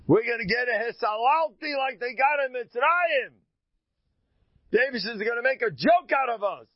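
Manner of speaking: high, anxious Philistine voices; fearful Philistines. Acted. Philistines fretting they'll be mocked, high anxious voice. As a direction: voice the worried Philistines well up.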